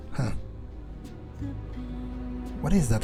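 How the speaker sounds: ordinary delivery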